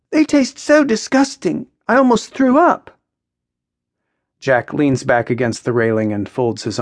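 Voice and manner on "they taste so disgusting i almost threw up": high-pitched revulsion; disgusted. Acted. Maya complains about Jack's cigarettes, disgusted. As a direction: complain with high, emphatic distaste.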